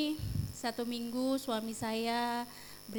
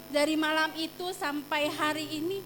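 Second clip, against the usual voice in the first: ordinary talk, nothing marked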